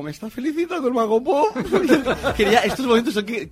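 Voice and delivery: high, loud, laughing disbelief; laughing excitedly. Retold or imitated. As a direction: giggle through the words, thrilled. Recalling being congratulated, laughing.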